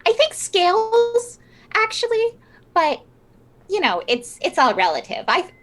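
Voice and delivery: very high, put-on seller's voice; correcting art seller. Player as Fiona corrects the buyer.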